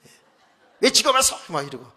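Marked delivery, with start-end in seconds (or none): 0.8-1.3 loud, high telling-off; mock-angry doctor's outburst; imitated scolding. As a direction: bawl the patient out like a cranky doctor. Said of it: "가면 막 혼내요".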